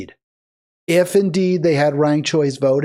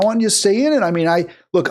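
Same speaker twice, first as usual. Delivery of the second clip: usual speaking voice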